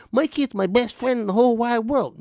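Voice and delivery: mock a parent gushing over the kid; mocking doting parent. Mocking a parent who calls the kid a best friend.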